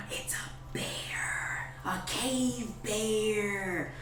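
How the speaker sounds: drawn-out, awed half-whisper after a gasp; gasp, then stretch it out in hushed wonder; small kobold child. Acted. Small kobold spotting a bear, hushed awed child voice. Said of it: "a small kobold, comes up and says".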